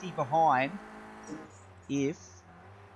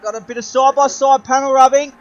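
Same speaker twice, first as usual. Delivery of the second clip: motorsport commentator; 10 dB louder than usual. Acted. Live race play-by-play.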